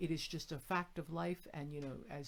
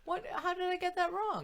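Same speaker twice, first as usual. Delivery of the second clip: high indignant question; protest, high and loud; indignant surprise; 13 semitones higher, 5 dB louder than usual. Real, not acted.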